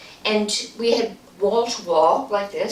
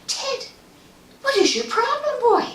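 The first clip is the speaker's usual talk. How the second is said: much higher, pushed-up voice; exasperated call; 3 dB louder than usual. Retold or imitated. Narrator at the top of the stairs demanding what's wrong with Ted, high exasperated call. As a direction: call up the stairs to the boy, fed up. Asking the frightened son.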